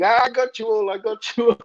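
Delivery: high-pitched imitation of a singer's "gotcha"; excited high voice, retelling the prank; prankster's gleeful reveal. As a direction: mimic him crowing at his fooled bandmates. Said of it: "he's kind of going".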